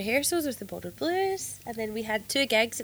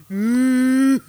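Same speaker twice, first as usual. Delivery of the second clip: cow moo; 2 semitones higher, 12 dB louder than usual. Retold or imitated. Imitating the bull's noise.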